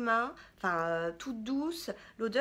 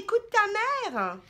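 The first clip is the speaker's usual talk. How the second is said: mock stern; 8 semitones higher, 7 dB louder, slower than usual. Mother lectures daughter.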